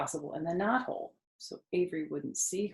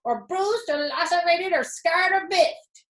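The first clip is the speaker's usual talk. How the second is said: high honking goose voice, building louder; 10 semitones higher, 5 dB louder than usual. A narrator's reading. Goose fussing at the pig about the fair. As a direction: cackle it out like a fussy goose. Goose voice, Charlotte's Web reading.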